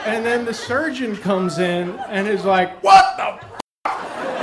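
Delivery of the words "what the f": high, startled yelp; shocked outburst. Retold or imitated. Surgeon walking in on the fallen dad, high shocked cry. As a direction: burst out in disbelief.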